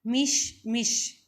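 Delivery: मिश मिश spelled out very slowly, each syllable clear; drawn-out मिश मिश for the children to copy; slow clear teaching word. Shown as a demonstration. Teacher slowly says मिश मिश so the children can copy it. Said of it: "next बच्चों word है".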